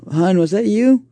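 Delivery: high, loud call to another room. Acted. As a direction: call out to her husband. Calling out to spouse.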